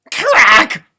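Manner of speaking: shouted sound-effect crack; crisp sound-effect shout; loud crack sound word. Acted. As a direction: bark out the crack like ice splitting.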